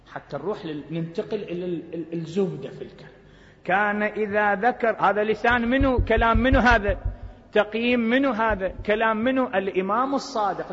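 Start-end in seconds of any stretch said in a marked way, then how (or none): 3.6-10.7 louder, higher rhetorical demand; forceful preaching. Acted. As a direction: hammer the question at the crowd. Preacher challenges the audience.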